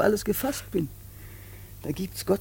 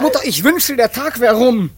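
exasperated; 3 semitones higher, 10 dB louder than usual. Acted. Son snaps at mother.

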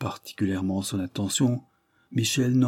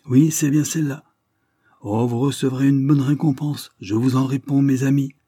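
raised, louder glad voice; relieved joy; 5 semitones higher, 5 dB louder than usual. Acted. Lidney thanking the divers, raised and glad. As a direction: let the relief burst out. Lidney has found his lost box.

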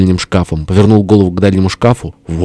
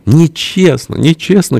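high, whiny little protest; crying out; 10 semitones higher, slower than usual. A narrator's reading. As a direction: wail that it's unfair. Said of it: "крикнул Фёдор Кузьмич, слава ему, из-под полок". (тонкий обиженный выкрик)

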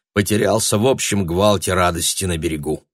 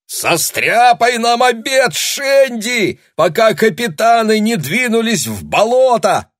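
high, loud cheerful shout; shouting pirate; 13 semitones higher, 4 dB louder than usual. A narrator's reading. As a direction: call out over the crowd. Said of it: "подхватил другой пират".

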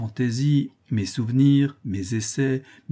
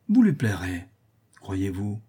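ordinary delivery, nothing marked

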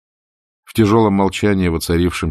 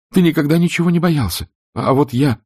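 pleading Scarlett; 9 semitones higher than usual. A narrator's reading. Scarlett pleading, voiced high.

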